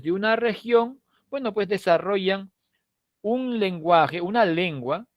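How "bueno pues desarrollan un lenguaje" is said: normal talking voice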